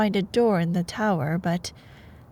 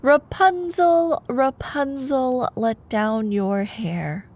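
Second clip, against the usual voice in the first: witch calling out; 2 semitones higher, slower than usual. Acted. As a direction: stretch the call up to the tower slowly. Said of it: "while she called out".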